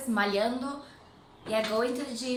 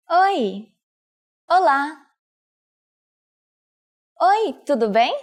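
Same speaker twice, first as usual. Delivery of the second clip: ordinary talk, nothing marked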